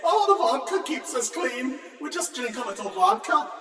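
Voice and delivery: mocking Russian cosmonauts. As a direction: ham up the cosmonauts' excuse. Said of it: "for they say".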